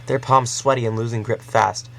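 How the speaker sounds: plain reading voice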